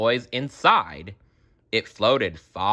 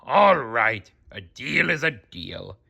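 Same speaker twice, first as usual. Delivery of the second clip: grumpily; 2 semitones higher than usual. A narrator's reading. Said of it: "said Mister Krabs grumpily".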